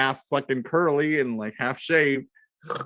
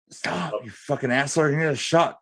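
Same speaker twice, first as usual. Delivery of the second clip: urgent warning; 5 semitones higher, 3 dB louder than usual. Retold or imitated. Urgent warning to dancing guy.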